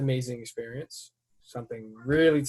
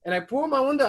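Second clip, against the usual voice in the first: normal talking voice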